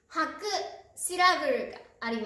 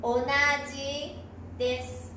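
measured dictation for learners writing it down. Shown as a demonstration. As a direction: read it out piece by piece with pauses. Dictation, word read out in pieces.

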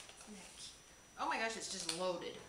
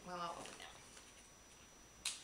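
usual speaking voice

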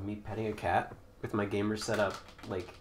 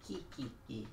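sneaky giggle; 13 dB quieter than usual. Acted. Sneaky giggle during fight.

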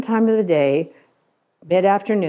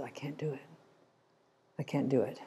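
barely audible, much softer, choked up; choked whisper; 3 semitones lower, 12 dB quieter, faster than usual. Retold or imitated. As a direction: let the words almost vanish, overcome with feeling. Shepherd cannot refuse the crying sheep.

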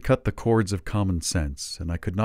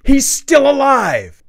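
slow, loud, much higher shout; roar; 13 dB louder, slower than usual. A narrator's reading. Dickens shouts to Dick outside, slow roar. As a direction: bellow the news out the door. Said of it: "roared at the top of his voice".

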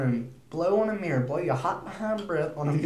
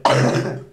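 sudden loud dry cough, on purpose; a forced cough to prove the point; loud demo cough; 9 dB louder than usual. Shown as a demonstration. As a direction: cough hard to show it off. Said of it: "see, just like that".

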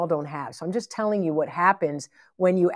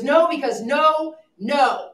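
emphatic refusal; 8 semitones higher, 3 dB louder, much slower than usual. Retold or imitated. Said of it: "Like that".

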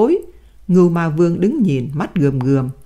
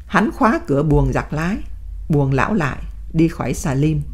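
usual speaking voice

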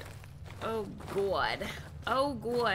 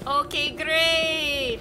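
sarcastic; 4 semitones higher, 5 dB louder, much slower than usual. Real, not acted. Sarcasm after a scare.

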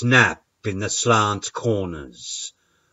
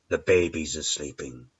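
hushed; 5 semitones lower, 7 dB quieter, faster than usual. A narrator's reading. After the hush, low and soft.

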